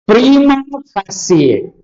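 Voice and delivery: slow, high "Prima facie", pree-ma fa-si-ye; careful Latin pronunciation. Shown as a demonstration. Teacher showing students the exact Latin, careful pronunciation. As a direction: draw out "Prima facie", ending in si-ye. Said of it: "pronunciation കൃത്യമായിട്ട് ശ്രദ്ധിച്ചു വെച്ചോളണം".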